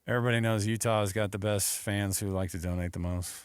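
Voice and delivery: imitating a bored, low recorded spot; least effort. Host mocking a coach's recorded radio spot, least effort. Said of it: "it was the least effort I've ever seen".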